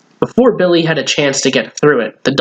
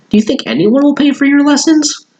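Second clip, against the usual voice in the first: put-on much higher, doubtful kid's voice; doubtful friend; 13 semitones higher, 3 dB louder than usual. Friend Aunt doubting Billy's lesson plan, high and unsure. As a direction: ask it unsure, pitched way up. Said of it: "Aunt didn't sound too sure".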